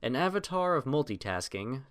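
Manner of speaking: plain reading voice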